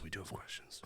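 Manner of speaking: breathy whispered repeat. Real, not acted. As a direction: breathe the words out, voiceless. Echoing own line, voice dropping.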